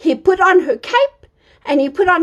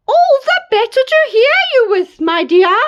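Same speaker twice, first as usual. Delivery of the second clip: wolf as granny; 9 semitones higher, 3 dB louder than usual. Acted.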